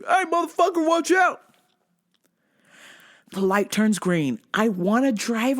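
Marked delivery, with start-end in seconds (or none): 0.1-1.4 high mock yell at traffic; yelling worker. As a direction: bark it like an annoyed road worker.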